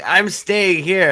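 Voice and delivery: mock stubborn daughter; defiant daughter. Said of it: "It's like".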